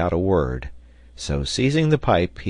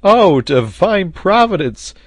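high, loud, slow exclamation; rapturous cry; 12 semitones higher, 7 dB louder, slower than usual. A narrator's reading. Sailor overjoyed by real tobacco, crying out in rapture. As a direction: cry thanks to heaven, high and drawn out. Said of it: "cried the sailor".

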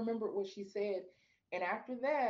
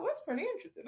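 intrigued; 11 semitones higher, much faster than usual. Retold or imitated. Quoting her intrigued reaction.

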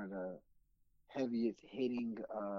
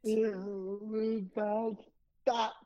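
drawn-out, high announcer call; 8 semitones higher, 4 dB louder, much slower than usual. Shown as a demonstration. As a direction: stretch it out like a show intro. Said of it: "You gotta do that again".

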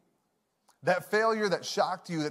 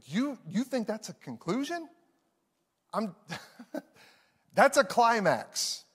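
amused God; 4 semitones higher than usual. Pretend. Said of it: "And God is saying".

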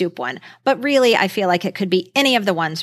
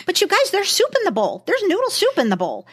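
excited; 8 semitones higher than usual. Real, not acted. Excited recall of soup.

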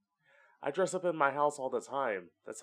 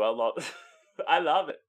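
normal talking voice

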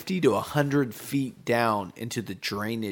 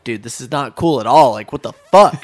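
fed-up protest; 12 dB louder than usual. Retold or imitated. Protesting to the guys in the tunnel, loud and fed up. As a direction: push back, annoyed and disbelieving.

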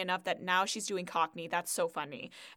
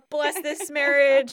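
loud, higher, drawn-out retold blurt; hammed-up blessing; 4 semitones higher, 9 dB louder, slower than usual. Imitating a wedding crasher, loud blurted blessing. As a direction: blurt it out big and slow. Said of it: "And she's just".

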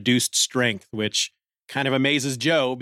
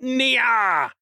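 high yelled battle cry; cartoon fighter's leaping yell; martial arts cry; 9 semitones higher than usual. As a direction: leap up with a mock kung-fu shout. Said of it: "calling out".

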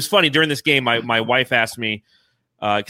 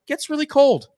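mock shiver; 11 semitones higher than usual. Real, not acted. Exaggerating Korean cold.

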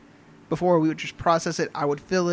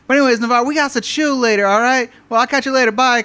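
high, loud acquaintance voice; chatty stoned caller; 6 semitones higher, 6 dB louder than usual. Retold or imitated. Weed-smoking caller to pharmacy worker, chummy high-pitched chatter. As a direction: rattle on as the over-familiar caller.

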